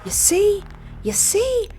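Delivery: high indignant pleading; see, I told you so; exasperated rant. Real, not acted. Player rants at the game.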